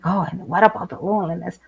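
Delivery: imitating worried people. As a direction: mimic people fretting about loneliness. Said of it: "people, they always think".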